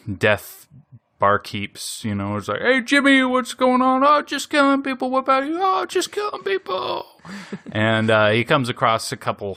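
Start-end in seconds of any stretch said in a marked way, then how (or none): 2.6-4.0 high, loud chirpy greeting; high cartoon barkeep voice; cheery barkeep. Barkeeps greeting each other. 4.0-5.6 high, breezy cartoon voice; high casual cartoon voice; chirpy barkeep. Barkeeps chatting about killing. 5.6-7.1 even higher, squeaky reply; squeaky cartoon echo; squeakier barkeep.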